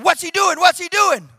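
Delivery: high, loud repeated question; alarmed questioning. Retold or imitated. Doctors running in to the noisy patient, alarmed shout. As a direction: fire it out urgently, twice.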